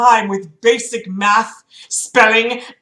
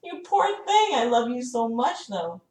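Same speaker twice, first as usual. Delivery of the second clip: cooing to the cat; 5 semitones higher, 7 dB quieter than usual. Real, not acted.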